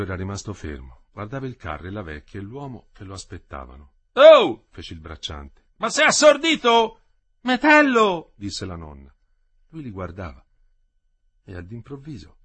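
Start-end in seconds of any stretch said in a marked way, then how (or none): 4.1-4.7 high, loud shout; loud call. Acted. Farmhand calling to the motionless boy, loud shout. 5.8-7.0 high, loud, slow shout; impatient shout. Acted. Farmhand yelling at the unresponsive boy, loud and slow. 7.4-8.3 high, loud call; calling his name. Acted. Grandmother calling Metello back, loud high call.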